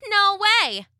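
high cheeky cookie voice; gingerbread man voice. Acted. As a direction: toss it off, cocky.

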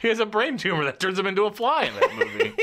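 laughing. Real, not acted. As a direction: laugh your way through it. Laughing movie joke.

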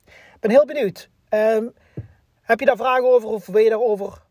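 ordinary delivery

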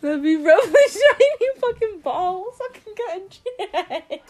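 high, loud, slowed by laughter; cracking up while teasing; laughing hard. Real, not acted. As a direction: squeal it out through helpless laughter. Teasing bald man, cracking up.

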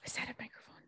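hushed whisper to a puppy; whisper to dog. Real, not acted. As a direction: whisper as if not to startle him.

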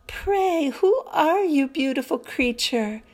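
high, awed question; in wonder. A narrator's reading. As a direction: marvel at her as you ask. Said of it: "gazing with wonder".

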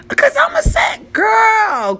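very high, wailing holler; squealed comic exclamation; exclaimed disbelief. Real, not acted. Comic exclamation over the drink.

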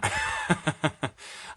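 high burst of laughter; laughing. Real, not acted. Comedian cracking up after a dark joke, laughing. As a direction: laugh freely at your own joke.

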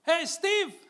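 high, loud holler over the fence; shouted friendly greeting; neighbourly shout. Acted. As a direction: call out to the neighbour next door. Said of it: "nos gritamos por el fence".

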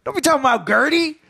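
high, hammed-up offended relative; mock indignation. Joking as Joe defending his aunt, high mock indignation. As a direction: play at being protective and affronted, pitched high.

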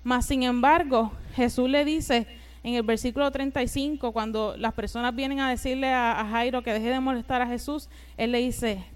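normal talking voice

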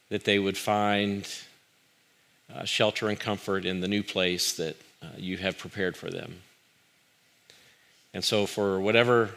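soft, low prayerful voice. Acted. Pastor leading the church in prayer, soft and reverent. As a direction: pray it quietly and reverently.

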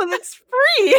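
high, loud laughing protest; hammed-up, high-pitched denial; mock protest. Customer protests to theater staff over the seasoning, mock indignant. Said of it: "And I was like".

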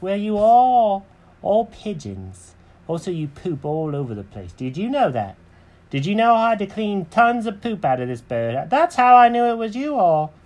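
high-pitched indignant cat, rising to a peak; Jenkins complaining. Acted. Cat scolds pigeons about the mess, high complaining voice. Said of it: "He goes".

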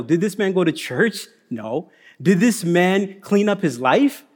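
ordinary talk, nothing marked

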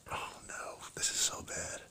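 voiceless, hushed dread; whispered dread. Retold or imitated. Cop alone in his car whispering dread. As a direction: whisper it like you're dreading what's next. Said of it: "I'm like".

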